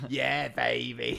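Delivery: slow drawn-out Austin Powers quip. Retold or imitated. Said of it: "You're gonna do an Austin Powers line".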